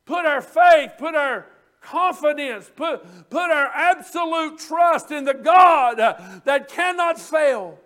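full-voice preacher's shout; shouting the charge to trust; preaching shout. Real, not acted. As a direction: call it out to the congregation.

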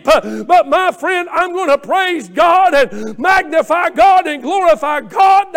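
building shout. Acted.

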